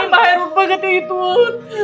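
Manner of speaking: slow, drawn-out tearful wail; crying plea. Acted. Mother-in-law begging to watch from outside, crying. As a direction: plead through sobs, dragging the words. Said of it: "रडू नका".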